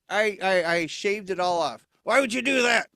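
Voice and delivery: ordinary delivery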